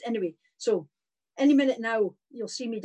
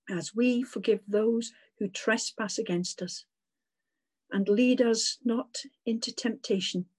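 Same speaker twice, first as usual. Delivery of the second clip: prayer recitation. Acted. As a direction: recite it steady and reverent. Congregation recites Lord's Prayer.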